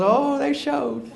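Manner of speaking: slow, high and amused; knowing. Retold or imitated. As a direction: let the joke land slowly with a laugh. Neighbor reveals the wrong roof.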